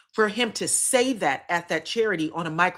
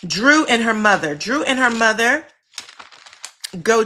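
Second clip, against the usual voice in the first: louder, calling out the next topic; loud announcement; 3 semitones higher, 6 dB louder than usual. Real, not acted. Host announcing the next scene to the chat, loudly. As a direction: announce it like a headline.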